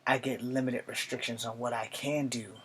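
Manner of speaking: put-on measured, earnest young soldier with long pauses between phrases; Charlie Iron Knife. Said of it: "I'm going to be a character from G I Joe named Charlie Iron Knife".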